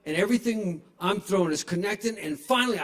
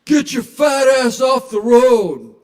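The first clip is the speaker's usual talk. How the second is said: mock yell; 7 semitones higher, 12 dB louder, slower than usual. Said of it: "this guy in a pickup truck that yells".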